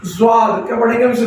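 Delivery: usual speaking voice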